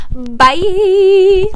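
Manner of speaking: high loud bye; cheery. Real, not acted. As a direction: sing the goodbye.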